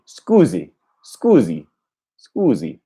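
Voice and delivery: scusi with a buzzing z; repeating Italian. Shown as a demonstration. Learner echoing the tape, Italian scusi. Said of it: "make your pronunciation sound exactly like his".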